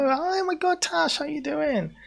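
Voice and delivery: very high, delighted hello; excited squeal. Real, not acted. Greeting a viewer.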